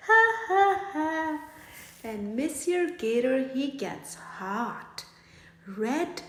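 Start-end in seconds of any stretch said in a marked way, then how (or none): 0.1-1.4 loud high ha ha ha laugh; mocking laughter. Critters on their backs laugh at gator, loud high ha ha. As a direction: burst out laughing at him. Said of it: "and laugh till they cry".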